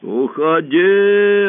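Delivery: loud, otherworldly roar; roaring monster. A narrator's reading. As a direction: roar the refusal. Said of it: "ответило чудовище".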